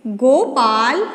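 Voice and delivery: each word stretched with long gaps; slow dictation. Shown as a demonstration. Teacher dictating a calling sentence to pupils, slowly. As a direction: spell the sentence out for little writers. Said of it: "dictating sentence".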